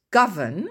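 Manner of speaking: Govern loud, stress on GOV. Shown as a demonstration. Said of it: "l'accent tonique en première syllabe". (accent tonique sur GOV dans Govern)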